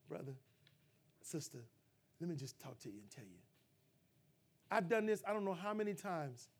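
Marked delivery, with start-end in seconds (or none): 0.1-3.4 much softer, lower, tender approach; quiet brotherly invitation to talk; gentle hush. Pretend. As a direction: lean in close and speak softly. Arm around them, gentle talk.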